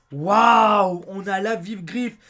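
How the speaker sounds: loud delighted wow; excited wow. Real, not acted. Surprised at getting the item. (un waouh crié de joie)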